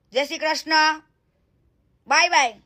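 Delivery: usual speaking voice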